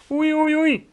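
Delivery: very high, loud yelps; pained yell. A narrator's reading. Giant with girl talking in his ear, pained yell. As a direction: shriek as if your ear hurts. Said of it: "gilde de G V R".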